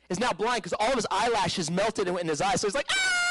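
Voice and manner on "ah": high, imitated panicked scream; high-pitched mock wail; freaking-out scream. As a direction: mimic a man freaking out in pain. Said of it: "he's, like, freaking out".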